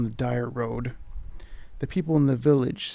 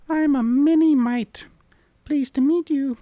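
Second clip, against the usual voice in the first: much higher, louder, slower sprite voice; tiny creature, slowed; 13 semitones higher, 7 dB louder than usual. Acted. Mini mite introducing itself after a backflip. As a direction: squeak it out proudly after a bow.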